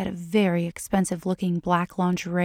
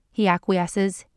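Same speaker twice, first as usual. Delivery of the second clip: ordinary delivery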